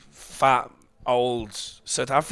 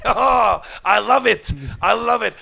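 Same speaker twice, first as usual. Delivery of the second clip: louder, higher gushing praise; love it out loud; delighted old man; 8 semitones higher, 9 dB louder, slower than usual. Retold or imitated. Imitating man loving the joke.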